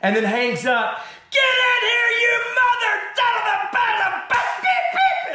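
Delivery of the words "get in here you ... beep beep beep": hammed-up angry boss shout; furious yell. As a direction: roar at him to come in now. Boss yelling after hanging up.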